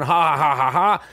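imitating the players laughing together; imitated laughter. Comic retelling Jordan and Moses laughing, mock laugh. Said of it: "They're laughing".

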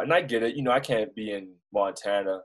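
usual speaking voice

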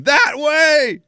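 high shout of "That way"; mock shout from the box; yelling. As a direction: point the runner the right way. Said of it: "Can you see me in the background going".

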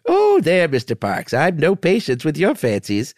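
scoffing Missus Bunch. Acted. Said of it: "Missus Bunch is transforming".

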